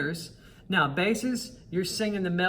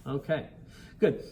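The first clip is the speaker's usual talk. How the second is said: usual speaking voice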